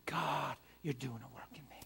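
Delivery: usual speaking voice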